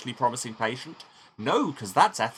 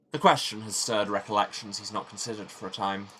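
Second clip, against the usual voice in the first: usual speaking voice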